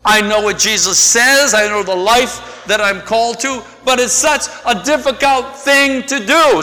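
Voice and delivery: high, complaining voice of a reluctant believer; whiny excuse. Retold or imitated. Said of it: "some of you are saying".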